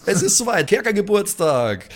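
pumped-up birthday announcement; excited. Real, not acted. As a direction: announce it like a party's starting.

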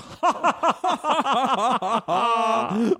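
high, drawn-out villain cackle; German evil laugh. Acted. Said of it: "that's his German evil laugh".

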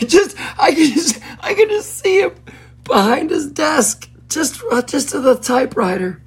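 words squeezed out through helpless giggles; laughing fit. Real, not acted. Host cracking up at Bruce Lee writing about the WHO, laughing through it. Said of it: "I'm choking on my own laughter".

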